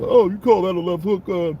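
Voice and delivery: high, loud, cocky boxer brag; mock a pompous fighter's boast; pompous boxer impression. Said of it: "His attitude was kind of fucking pompous".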